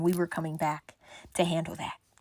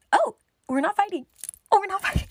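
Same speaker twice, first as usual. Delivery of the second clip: much higher, louder, in surprise; high surprised; 12 semitones higher, 4 dB louder, faster than usual. Retold or imitated. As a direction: squeal it, caught off guard. Surprised relief as friend points.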